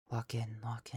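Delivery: hushed whisper, muttered to oneself; whispered self-motivation. Acted. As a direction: psych yourself up under your breath. Psyching self up under the breath.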